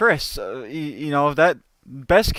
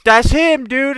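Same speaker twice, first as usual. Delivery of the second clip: higher, louder call pointing out the culprit; excited call; 7 semitones higher, 4 dB louder, slower than usual. Retold or imitated. Merrick points him out to the gangster, loud and excited. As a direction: sing out the betrayal.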